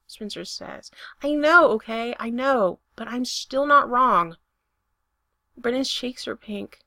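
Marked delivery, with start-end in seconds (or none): 1.2-4.4 louder, pressing insistence; insistent. Acted. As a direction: insist over him. Insisting during argument.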